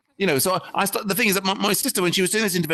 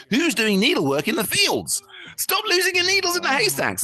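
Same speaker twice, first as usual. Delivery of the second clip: exasperated joke complaint climbing much higher; mock indignant; 14 semitones higher than usual. Comedian retells his club joke, mock indignant high complaint. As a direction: scold the hay-field sewers in disbelief. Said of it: "And I was thinking".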